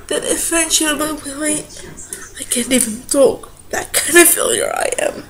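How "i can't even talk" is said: ordinary talk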